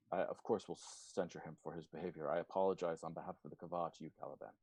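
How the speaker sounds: a hint of a dignified leader apologizing; formal apology. Nuja apologizing to Caliban, low and fast. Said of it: "and says".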